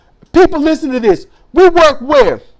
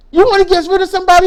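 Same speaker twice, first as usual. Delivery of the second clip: usual speaking voice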